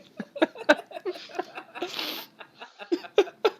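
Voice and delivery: high burst of giggles; can't hold back the laugh; breaking character giggling. Real, not acted. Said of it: "I can't keep a straight face".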